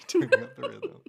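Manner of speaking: high, loud laughing DJ call; DJ hype voice. Pretend. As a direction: shout it like a radio DJ. Mock DJ hype line.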